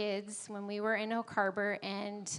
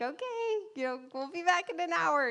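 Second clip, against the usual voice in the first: much higher, airy carefree farewell; breezy singsong goodbye; 8 semitones higher than usual. Retold or imitated. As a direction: toss it off lightly as you leave the baby. Acting out her breezy goodbye.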